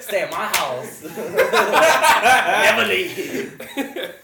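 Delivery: high, slow, loud words cracking with laughter; laughing mock creep. Joking to friends about keeping Linda, laughing hard. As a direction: play the creepy captor, laughing.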